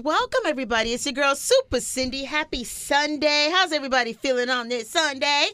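upbeat on-air welcome; radio host intro. Acted. Radio host opening the Sunday show, upbeat announcer. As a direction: greet the listeners like a radio host.

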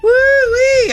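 high, loud woo-wee; excited whoop. Real, not acted. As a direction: whoop it up for the contestants. Cheering the contestants in.

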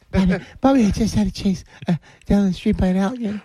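high fake voice.

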